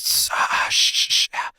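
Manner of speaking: hissing tss and shh sounds, unvoiced; airy sibilance imitation; sibilant hiss demo. Shown as a demonstration. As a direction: spit out the harsh esses like a bad vocal. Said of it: "get rid of the tss".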